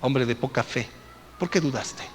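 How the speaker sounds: low and soft, searching rebuke; quiet challenge. Acted. Jesus challenging Peter, low and soft. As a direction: ask it softly, holding his gaze. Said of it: "Y le dijo".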